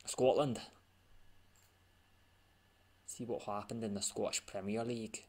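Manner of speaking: a mock Scottish announcer, not too convincing; bad Scottish accent. Gamer slips into a jokey Scottish accent while announcing leagues, hammed-up Scottish accent. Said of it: "Sorry for the bad Scottish accent".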